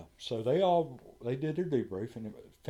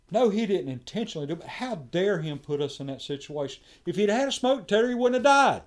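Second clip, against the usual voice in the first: rising from heated to a full shout, faster; heated, then shouting, higher and louder; building retold anger; 9 semitones higher, 9 dB louder than usual. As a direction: let the outrage climb until you're yelling. Angry outburst at the debrief.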